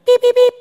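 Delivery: high, loud electronic beeps; imitated alarm beeps. Detector going off at two in the morning, shrill mimicked beeps.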